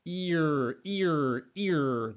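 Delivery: ear, drawn out to show the R; isolating ear slowly. Shown as a demonstration. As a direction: stretch out ear so the R is heard. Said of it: "that second sound".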